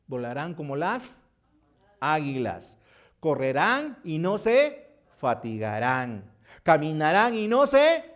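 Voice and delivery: scripture read building to a loud, high cry; climbing from strong to ringing. Acted.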